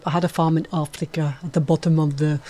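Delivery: light Danish accent, a gentle Out of Africa imitation; a playful attempt at a Danish accent in the film's famous opening line; Meryl Streep Danish accent. Radio host briefly imitating the Out of Africa opening line, light Danish accent. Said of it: "I thought that was a decent accent".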